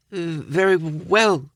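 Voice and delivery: slow, timid stammer. A narrator's reading. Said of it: "stammered Coghlan".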